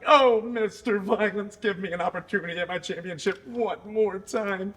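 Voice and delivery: lightly voiced whiny rival, begging; mock whining. Mocking Willie Mack's begging to Kerry, whiny mock plea. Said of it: "Willie Mack's been whining, crying".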